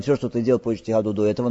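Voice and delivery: usual speaking voice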